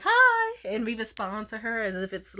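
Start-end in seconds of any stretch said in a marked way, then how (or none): 0.0-0.4 high, loud cheery hi back; crowd answering hi. Retold or imitated. Strangers on the street greeting a child, high and cheery. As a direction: answer the little girl all together, high and sunny. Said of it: "we're like".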